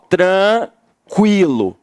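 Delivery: tranquilo stretched slowly; teacher dividing the word; slow syllable split. Shown as a demonstration. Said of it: "eu tô tranquilo".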